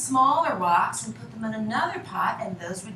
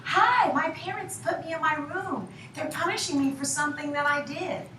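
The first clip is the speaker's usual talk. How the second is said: higher-pitched mock yell to passers-by; child calling out the window; 6 semitones higher than usual. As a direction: call out to strangers as a kid, without really yelling. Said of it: "I would yell out the window".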